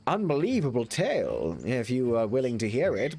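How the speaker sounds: lofty gentleman dismissing the idea; hammed-up pompous Englishman; Matt Berry impression. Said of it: "Matt Berry impression".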